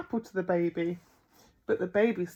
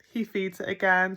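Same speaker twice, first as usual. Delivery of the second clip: plain reading voice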